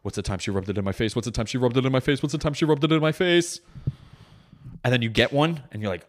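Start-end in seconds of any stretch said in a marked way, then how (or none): 0.0-3.6 frantic muttered repeat climbing in pitch; put on the spot. Husband searching memory mid-argument, frantically put on the spot. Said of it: "looking dumb as fuck on the spot".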